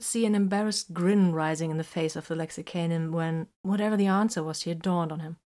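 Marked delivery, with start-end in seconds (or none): none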